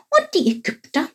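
high little questioning voice; curious Chilp. A narrator's reading. Chilp wants to know about the Egyptians, high curious voice. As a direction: pipe up high, wanting the next bit. Story figure Chilp asks.

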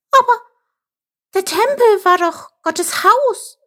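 very high, halting protest; stammering dismay. A narrator's reading. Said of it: "stammelte er".

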